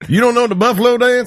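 put-on high-pitched Southern accent, incredulous question; mock Southern accent. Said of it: "without saying it with a Southern accent".

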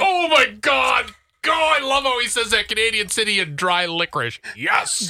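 mock over-excited fan voice; gushing listeners. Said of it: "our listeners are like".

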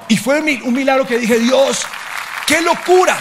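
loud, high, astonished cry; amazed exclamation. Real, not acted. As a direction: burst out in wonder at the miracle. Amazed at a child's healing.

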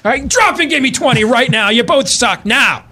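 high barked workout orders; barking gym coach. Retold or imitated. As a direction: yell orders like a drill sergeant. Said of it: "the New Year's pep talk".

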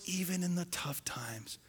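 a hushed, breathy whisper to the congregation. Real, not acted. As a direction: lean in and whisper the exhortation. Pastor's intimate exhortation.

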